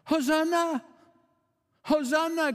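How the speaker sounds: high, sustained acclaiming cry; high-pitched imitation of a crowd's shout. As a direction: call it out like the cheering masses. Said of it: "the masses cried out".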